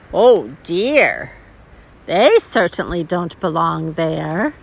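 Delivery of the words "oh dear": ordinary delivery, nothing marked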